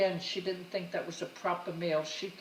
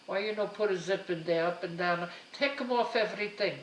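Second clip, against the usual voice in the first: mother's broken English; 4 dB louder than usual. Retold or imitated. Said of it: "Mama would always say to me".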